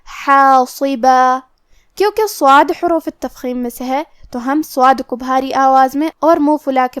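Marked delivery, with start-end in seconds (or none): 0.1-1.4 حاصبا recited very slowly, light حا and a long madd on the final alif; light recitation. Shown as a demonstration. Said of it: "حا کو اور مد الف کو ہلکی آواز میں پڑھنا". (حاصبا آہستہ پڑھیں، حا ہلکی اور الف لمبا کھینچیں)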